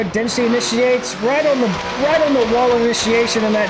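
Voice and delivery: quick, lifted live race call; play-by-play. Acted. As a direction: call the run as it happens.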